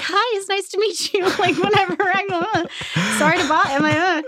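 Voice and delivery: high, breathless fangirl voice; starstruck squeak. Retold or imitated. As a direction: meet a star, flustered and shy. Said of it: "and I'm like".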